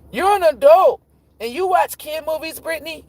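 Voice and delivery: put-on high squeaky kid voice, teasing; mock a nosy little kid; imitating a kid. Step kids teasing Brittany in a high squeaky kid voice.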